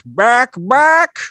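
rising excited home-run call; announcer call. Pretend. Calling fly ball.

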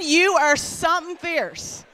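"you are something fierce" called out, Newfie lilt; loud Newfie compliment. Shown as a demonstration. Preacher calling out to Kristen, loud and warm. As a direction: hype up a woman in the room. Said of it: "In your best Newfie tongue".

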